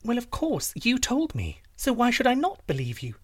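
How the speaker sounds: very high, earnest princess; guileless princess. Acted. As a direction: protest innocently in a high-pitched voice.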